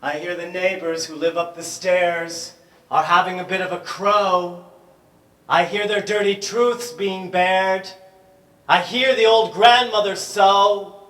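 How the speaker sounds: high, loud declaiming shout. Acted. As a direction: bellow the lines out high. Poem building to a shout.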